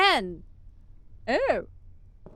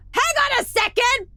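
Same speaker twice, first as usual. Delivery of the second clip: excited disbelief; 13 semitones higher, 4 dB louder than usual. Real, not acted. Exasperated at American pronunciations.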